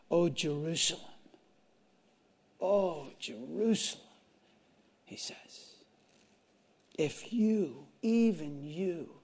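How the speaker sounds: soft, slow lament sinking to a whisper; grieving over the city, fading to breath; Jesus lamenting. Retold or imitated. Preacher voicing Jesus weeping over Jerusalem, hushed lament. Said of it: "He's crying out".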